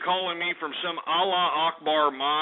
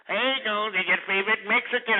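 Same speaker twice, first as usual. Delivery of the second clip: higher, sneering imitation of the caller; mocking the caller; 5 semitones higher than usual. Host mimicking the prank caller in a mocking voice. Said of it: "I mean, you know, this".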